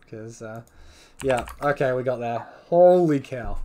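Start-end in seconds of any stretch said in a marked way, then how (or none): 2.7-3.6 excited. Real, not acted. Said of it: "a little bit excited at the moment".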